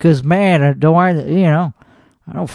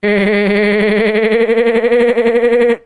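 laugh. Acted. Laughs on the show.

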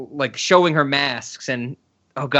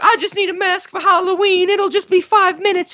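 put-on very high-pitched pleading girl voice; imitated pleading girl.

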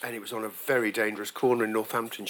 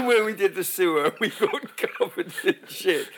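high, laughter-filled telling; real laughing; 5 semitones higher than usual. Real, not acted. Recalling the sewer mishap to friends, laughing. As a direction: laugh helplessly through the words.

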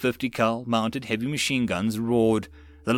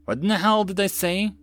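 high-pitched baffled question; confused; 8 semitones higher than usual. A narrator's reading. Co-pilot baffled by the radio call, confused. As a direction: ask it in raised-pitch confusion. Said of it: "asked in confusion".

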